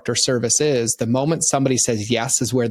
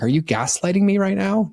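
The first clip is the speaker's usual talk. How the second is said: indignant disbelief; 8 semitones higher than usual. Retold or imitated. Acted quarrel with restaurant waiter.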